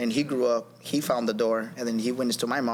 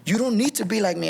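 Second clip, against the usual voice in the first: high-pitched, emphatic mock reproach; retold yelling; 7 semitones higher than usual. Father telling son not to copy him, mock yell. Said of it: "he started yelling at me".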